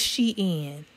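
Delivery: Shein in two syllables, "SHEE-in"; correct pronunciation. Shown as a demonstration. Correcting viewers on the brand name, Shein pronounced "SHEE-in". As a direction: split Shein clearly into "she" plus "in". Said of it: "That's wrong. It's Shein".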